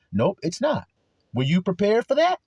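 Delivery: ordinary talk, nothing marked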